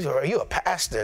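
low, lightly voiced indignant churchgoer; a hint of a scandalized member asking; mock shocked question. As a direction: imitate someone scandalized, understated.

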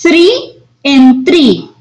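the two threes said slowly side by side; contrast tree and three. Shown as a demonstration. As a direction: play the wrong three against the right three. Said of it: "¿Pueden escuchar la diferencia".

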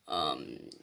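normal talking voice

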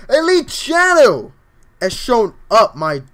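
loud, high, drawn-out name call; hyped shout-out. Real, not acted. Streamer hailing a viewer who showed up, hyped shout. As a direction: stretch the name out like a hype announcer. Hails a viewer joining chat.

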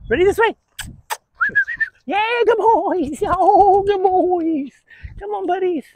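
very high, loud, drawn-out praise voice; high dog praise. Real, not acted. Trainer getting dogs excited, very high squealed praise.

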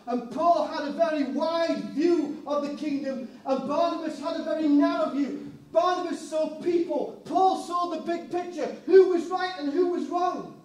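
preaching at full force. Acted. Preacher building to the congregation, loud and rising. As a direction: drive the point home to the congregation.

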